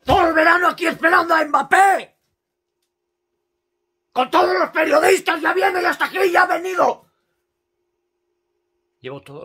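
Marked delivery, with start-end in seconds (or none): 0.1-7.1 loud and high ranting; angry shouting. Real, not acted. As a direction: shout the whole rant. Ranting about Mbappé.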